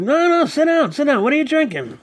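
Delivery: high, slurred insisting voice; slurry high mimicry. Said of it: "and he's like".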